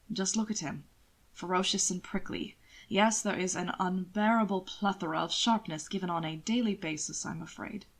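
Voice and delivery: a sardonic gentleman savouring his jab; suave lord, dry. Acted. Hannibal teasing Will.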